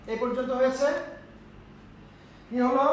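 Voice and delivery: ordinary delivery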